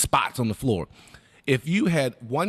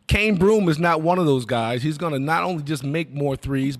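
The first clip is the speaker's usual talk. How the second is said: ordinary talk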